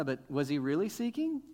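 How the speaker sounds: ordinary talk, nothing marked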